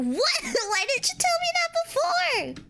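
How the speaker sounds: very high, slow laughing complaint; laughing disbelief. Real, not acted. Kid to dad who hid the reason, laughing exasperated whine. Exasperated at the dad's late news.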